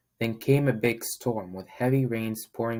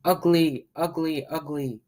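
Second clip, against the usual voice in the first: higher squawking chant, fading; squawked taunt; 6 semitones higher, 3 dB louder than usual. Acted. Duckling taunting the ugly duckling, squawking. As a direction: taunt like a bratty chick. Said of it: "the other duckling squawked".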